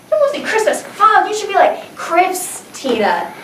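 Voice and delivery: high, slow sassy friend voice; teasing friends. Retold or imitated. Friends nickname her while she dances, high and sassy. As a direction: tease her with sass.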